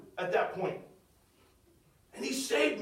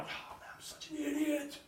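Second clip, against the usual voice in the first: self-reproach; 3 semitones higher, 11 dB quieter than usual. Retold or imitated. Berating himself amid the scene.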